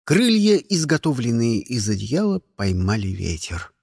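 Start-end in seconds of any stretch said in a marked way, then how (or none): none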